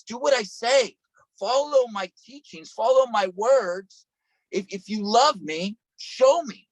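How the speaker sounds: rising sermon intensity; building preacher fervor. Acted. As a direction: drive it up to a peak. Preacher building on Jesus' words.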